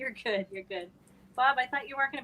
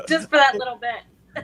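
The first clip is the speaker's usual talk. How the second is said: laughing; 3 semitones higher, 10 dB louder, slower than usual. Real, not acted. Laughing echo.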